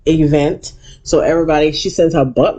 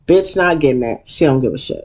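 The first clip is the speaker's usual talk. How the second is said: ordinary talk, nothing marked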